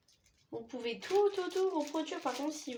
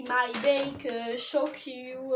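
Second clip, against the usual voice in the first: bad English accent; 8 dB louder, much slower than usual. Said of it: "Mon accent est pourri".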